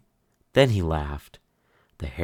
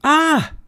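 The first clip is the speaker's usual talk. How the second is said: high, loud startled gasp; father jumping in fright; 10 dB louder than usual. Acted. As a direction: gasp as if caught off guard. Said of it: "gasped his father".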